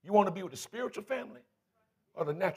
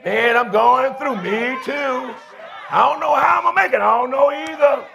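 mock ditch partners; 6 semitones higher, 10 dB louder than usual. Preacher mimics ditch partners sharing their troubles, mock lament. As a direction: imitate two sufferers commiserating in long, sung-out moans. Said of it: "I'm tired of these ditch partners".

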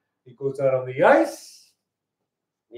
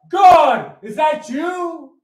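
high, loud, drawn-out shout; calling out to God; 13 semitones higher, 9 dB louder, much slower than usual. Acted. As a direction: shout up to heaven in wonder. Joke guy calls up to God.

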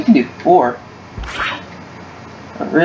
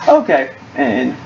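ordinary delivery

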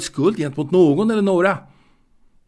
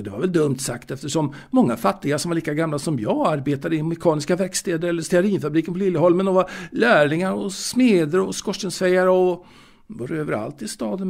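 usual speaking voice

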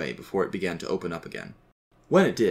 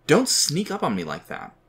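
higher-pitched, startled reproach; indignant, raised-pitch telling-off; startled scolding; 7 semitones higher, 3 dB louder than usual. A narrator's reading. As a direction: scold someone who just made you jump. Sam startled by his father.

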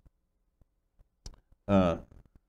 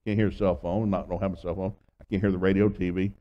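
ordinary delivery

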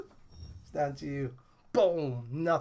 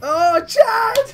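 much higher, louder cry of his name; excited shout; 10 dB louder than usual. Real, not acted. Gamer greets a pulled character, excited shout. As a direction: yell the name in delight. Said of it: "When I say his name".

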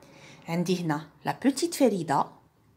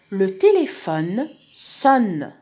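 slow, louder, deliberate story opening; slow model reading; 5 dB louder, much slower than usual. Shown as a demonstration. Teacher opening a dialogue for pupils, slow model reading. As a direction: announce it slowly and clearly.

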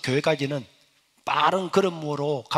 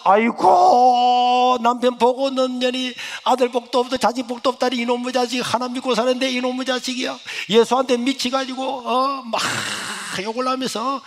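wailing lament; 8 semitones higher, 5 dB louder than usual.

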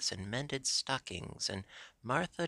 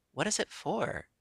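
high-pitched, puzzled question; curious; 8 semitones higher than usual. A narrator's reading. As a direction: ask with open curiosity, voice lifted. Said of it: "she asked curiously".

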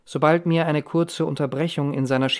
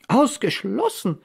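higher, drawn-out cry of disbelief; incredulous exclamation; 8 semitones higher than usual. A narrator's reading. Mother reacts to Rose's news, exclaiming in disbelief. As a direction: exclaim it slowly, pitch leaping up. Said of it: "rief meine Mutter".

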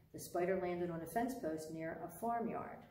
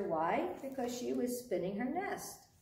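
ordinary delivery

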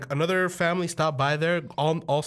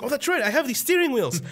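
high-pitched eager discovery; lightly acted realization; 9 semitones higher than usual. Sledding boy remembering the handles, pleased realization. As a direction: pitch it up as if it just dawned on you.

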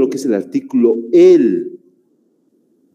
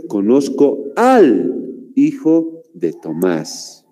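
very slow, "al" joined; slow correct form; much slower than usual. Shown as a demonstration. Teacher slowly modelling the correct sentence for class. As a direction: spell out "Conozco al hijo" slowly. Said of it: "Entonces, lo correcto es".

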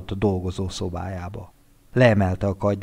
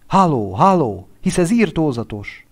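loud, raised calls into the phone; anxious friend shouting into a phone; calling down the line; 7 semitones higher, 4 dB louder than usual. Acted. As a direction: call out for him on the line.

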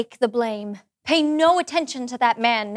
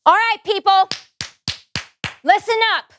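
loud, higher call to attention; 7 semitones higher, 5 dB louder than usual. Acted. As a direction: call the household together. Abigail calls her servants.

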